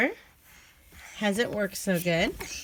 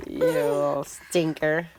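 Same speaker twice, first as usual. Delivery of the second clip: slow, low playful scolding; affectionate mock reproach; teasing mock scold; 6 semitones lower, 4 dB louder, slower than usual. Real, not acted. Mother teasing toddler after laughing.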